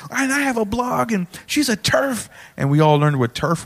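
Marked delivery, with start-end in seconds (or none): 0.1-2.3 very high, whiny caricature of complaint; mock indignant heckler. Heckler on stage accusing the host, mock indignant. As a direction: protest in a mocking high voice.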